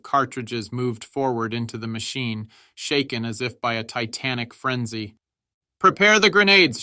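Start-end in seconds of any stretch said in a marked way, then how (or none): 5.8-6.8 high, very loud command; urgent battlefield bellow; shouted order. A narrator's reading. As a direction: yell it over the gunfire. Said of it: "shouted the Stabs".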